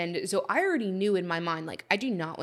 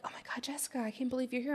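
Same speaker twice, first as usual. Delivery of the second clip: hushed, unvoiced whisper; 6 semitones higher, 7 dB quieter, much faster than usual. Networking people gasping at Jessica, in a whisper. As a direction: whisper it like gossip. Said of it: "people were like".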